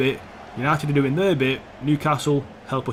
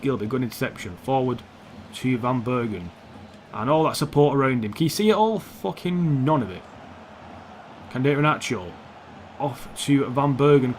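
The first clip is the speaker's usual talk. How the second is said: usual speaking voice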